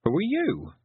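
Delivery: high-pitched quick challenge; girl's retort. A narrator's reading.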